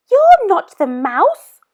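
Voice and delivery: put-on high, loud young monster voice, questioning; a bold little monster asking; Gruffalo's child.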